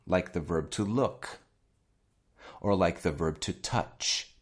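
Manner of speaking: ordinary talk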